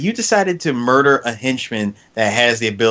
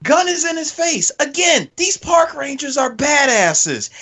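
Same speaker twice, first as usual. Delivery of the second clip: hyped; 12 semitones higher than usual. Real, not acted. Telling friends about the ranger, excited. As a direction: marvel at the badass ranger.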